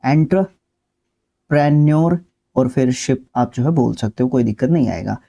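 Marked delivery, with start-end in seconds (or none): 0.0-3.3 एंटरप्रेन्योर और फिर शिप, said slowly with a pause between parts; slow syllable-split demo. Shown as a demonstration. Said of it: "कुछ इस तरीके से highlight कर सकता हूँ".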